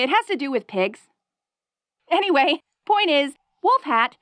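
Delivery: usual speaking voice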